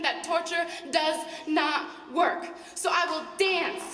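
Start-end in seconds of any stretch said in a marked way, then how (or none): 2.7-3.8 words flung out one by one, higher and louder, building to a scream; defiant crescendo. Acted. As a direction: throw each word harder than the last. Poem climax, defiant list building.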